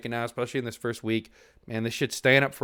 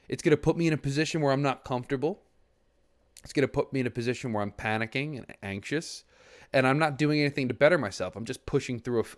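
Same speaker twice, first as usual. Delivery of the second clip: ordinary delivery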